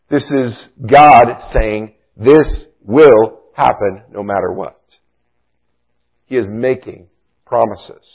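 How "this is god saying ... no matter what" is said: slow and louder, "this" hit hard at the start; "This will happen" pressed firmly, stress on "this"; emphatic. Shown as a demonstration. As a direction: give "This will happen no matter what" slow, heavy weight. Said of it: "It's emphatic".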